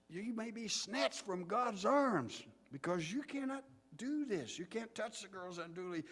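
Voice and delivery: high-pitched, slow fire-and-brimstone warning; passionate preacher. Retold or imitated. Preacher warning boys of Satan's power, passionate and drawn out. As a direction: stretch the key words with rising fervor. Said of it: "he was getting passionate about it".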